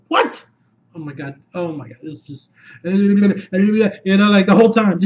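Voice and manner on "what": high, sharp burst of amazement; thrilled disbelief. Real, not acted. Fan amazed at the rescue suit, high thrilled disbelief. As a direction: blurt it out way up high.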